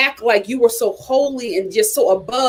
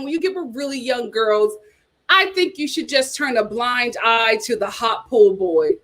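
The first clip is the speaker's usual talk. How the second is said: usual speaking voice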